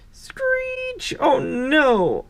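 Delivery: screech of alarm. Acted. Narrator as Thomas nears mud, high alarmed screech. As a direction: squeal like brakes, then cry out.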